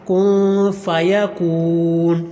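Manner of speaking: slow, long vowels; drawn-out recitation. Shown as a demonstration. Teacher demonstrating the long vowel in فیکون. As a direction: stretch فیکون with a long kaf. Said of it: "فیکون کے کاف کو لمبا کریں گے".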